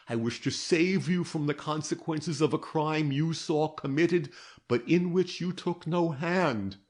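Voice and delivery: a hint of a patient interrogator; detective Gryce. A narrator's reading. Detective explains.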